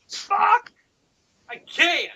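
very high squealed refusal; hammed-up, squeaky protest; mock exasperation. Refusing to do the accent for friend, mock exasperation.

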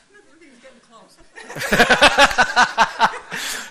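real loud high laugh; big laugh at his own joke. Real, not acted.

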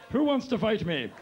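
high, loud cocky dare; laughing mock bravado to cast and crew; mock tough challenge. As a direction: puff up like a goofy brawler. Bravado in his underwear.

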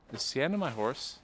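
ordinary delivery

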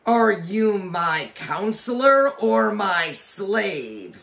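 high, loud, slow scornful demand; angry witch. A narrator's reading. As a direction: scorn him like a tyrant queen. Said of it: "said the witch".

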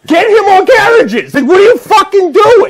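high, loud, slow outraged yell; mock outraged shout. Shopper shouting at manager about Greg, outraged. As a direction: demand the manager act, shouting. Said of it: "I'm like".

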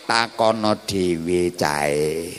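very slow, low and hushed; low slow reply. Acted. Mother deflects the crowd to her baby, low slow reply. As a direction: draw it out softly, well down in pitch. Maryam's imagined silent reply voiced.

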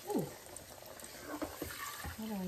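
normal talking voice

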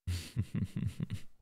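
breathy teasing chuckle; partner's quiet teasing laugh; soft whispered laugh. Acted.

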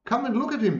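high-pitched, eager call; storyteller's high cry. A narrator's reading. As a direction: call them over, voice pitched right up. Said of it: "the brothers cried".

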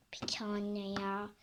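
a grumbling whispered okay. Acted. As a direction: give in under your breath. Daughter sulkily giving in.

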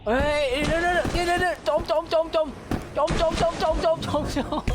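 high, frantic repeated cries of sinking; panicked high yelp. Real, not acted. Gamer panicking as his character drowns, frantic high cries. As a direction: panic as the water pulls you under.

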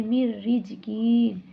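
slow chanted মির রিজকিন, the র doubled; recited with idgham. Shown as a demonstration. Teacher reciting মির রিজকিন for students. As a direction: join the two র sounds in মির রিজকিন. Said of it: "এরপর হবে কি".

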